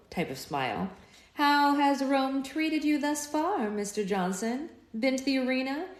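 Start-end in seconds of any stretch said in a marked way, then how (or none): 1.3-5.9 calm malice. A narrator's reading. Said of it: "with an odd amount of malice and calm".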